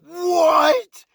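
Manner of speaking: high, loud rising what of disbelief; stunned what. Retold or imitated. Pit boss sees the impossible twenty-one, stunned disbelief. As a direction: let your jaw drop on it. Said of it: "And I'm sitting there like".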